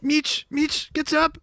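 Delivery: high-pitched mock scream; Kata impression. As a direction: imitate a teammate yelling at you. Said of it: "he'd be screaming like".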